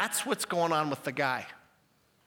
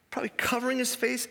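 normal talking voice

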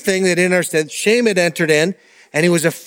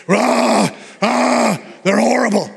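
lightly hammed-up scare-ad voice; mock attack ad; 4 semitones higher, slower than usual. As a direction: imitate an overblown smear commercial, pitched higher. Mimicking a scary attack ad.

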